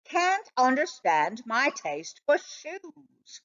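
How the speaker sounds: usual speaking voice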